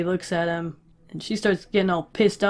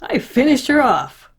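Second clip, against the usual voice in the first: raised, triumphant boast; higher-pitched gleeful bragging; proud boast; 6 semitones higher, 4 dB louder, slower than usual. Retold or imitated. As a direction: play an oblivious kid crowing over a kill. Oblivious character boasts of a kill.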